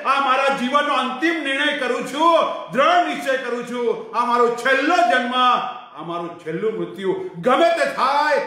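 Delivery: rising, loud preaching of a firm vow; impassioned vow. Acted. Seeker vowing to reach God, with fervour. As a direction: build into a fervent declaration.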